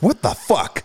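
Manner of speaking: mock a stunned "what the fuck"; incredulous look. Host voices the guest's stunned look, imitated disbelief. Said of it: "she looked at me like".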